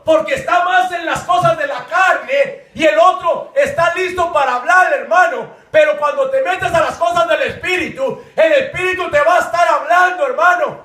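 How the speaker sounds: high, loud sermon delivery; impassioned, shouted exhortation; fervent preaching. Real, not acted. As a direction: drive each phrase home to the congregation. Preaches at full force.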